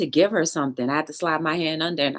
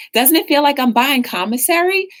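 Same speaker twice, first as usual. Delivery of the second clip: joking cashier bit; 8 semitones higher, 5 dB louder than usual. Retold or imitated. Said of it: "she was just dying laughing".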